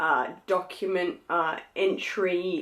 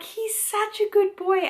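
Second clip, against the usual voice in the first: much higher sing-song coo; cooing at baby; 13 semitones higher than usual. Retold or imitated. Answering her husband about the baby, much higher coo. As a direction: coo it like praising a puppy. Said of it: "I'm like".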